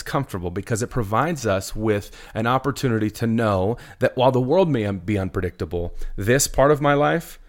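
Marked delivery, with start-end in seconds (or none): none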